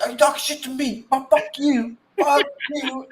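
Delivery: high, fast mock Scottish fury; hammed-up high Scottish rage; mock angry Scot. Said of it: "He's like".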